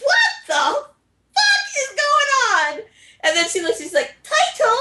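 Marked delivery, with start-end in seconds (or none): 0.0-2.8 very high, drawn-out squeal of disbelief; mock bewilderment. Viewer retelling his shock at the sudden stabbing, hammed-up bewilderment. 4.2-4.8 high-pitched mock cry of the name; Himeya. Storyteller voicing stabbed girl calling to her love, high mock alarm.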